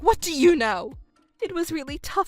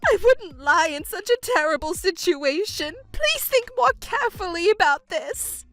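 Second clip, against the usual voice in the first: wailing mother; 8 semitones higher than usual. Acted. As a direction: wail in self-pity, voice rising. Mother wailing over lost daughter.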